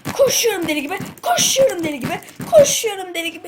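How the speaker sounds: high, loud excited chant; excited shout. Real, not acted. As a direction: yell it, thrilled while running. Running in game, excited.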